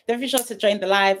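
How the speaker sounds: usual speaking voice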